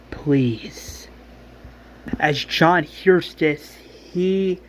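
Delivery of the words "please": breathy whispered please. Retold or imitated. Beth begging Billy to stay beside her, whispered. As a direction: plead in a whisper.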